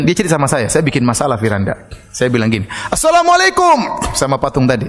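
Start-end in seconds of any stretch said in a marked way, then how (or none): 2.9-4.0 high, drawn-out shouted salam; loud greeting shout. Retold or imitated. Preacher retelling the sheikh greeting the idol, shouting. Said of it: "Karena dia teriak keras".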